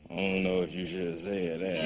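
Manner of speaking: low, soft whiskey voice; quiet second thoughts, pitched right down; Jack Daniels. Acted. Whiskey doubts what comedian just said, low and soft. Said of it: "that Jack Daniels said".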